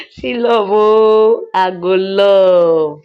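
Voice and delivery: loud, long-drawn mock swooning; teasing swoon. Real, not acted. Storyteller reacting to the lovers confessing, teasing swoon. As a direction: stretch out the vowels teasingly.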